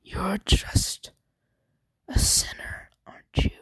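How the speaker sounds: unvoiced, creepy whisper; sinister whispered taunt; menacing whisper. Deku menaces the villain.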